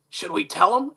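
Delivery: low, hushed raspy aside. Retold or imitated. Co-host asking his partner whether to reveal a secret, lightly raspy. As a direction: imitate a quiet raspy voice. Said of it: "kind of in the raspy voice".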